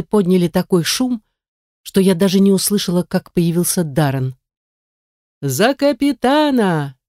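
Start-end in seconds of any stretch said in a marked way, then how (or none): 5.4-7.0 high, slow cheering toast; toast shout. A narrator's reading. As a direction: raise a glass and boom it out. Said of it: "грянул Джон".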